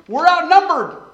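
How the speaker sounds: preacher's rising shout; emphatic cry. Real, not acted. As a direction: hit the climax of the list hard. Climax of the list of problems.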